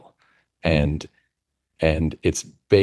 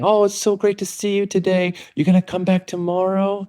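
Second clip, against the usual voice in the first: sing-song office staff; 11 semitones higher than usual. Retold or imitated. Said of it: "they're saying".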